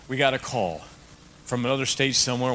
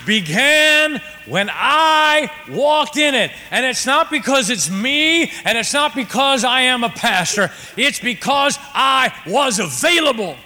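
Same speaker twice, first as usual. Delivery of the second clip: high, loud, slow sermon climax; preaching at full force; 12 semitones higher, 9 dB louder, slower than usual. Acted. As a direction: drive the point home to the church.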